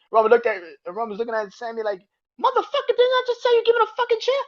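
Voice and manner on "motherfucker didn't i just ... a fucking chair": very high, fast, shrieking rage; furious yell. Retold or imitated. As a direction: explode at him, pitch way up and fast. Roman furious at Sami.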